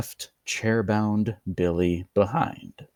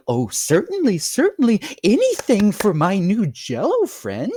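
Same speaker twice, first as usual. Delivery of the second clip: high, loud, sugary host; overly cheerful host; 10 semitones higher, 5 dB louder than usual. A narrator's reading. Host voiced.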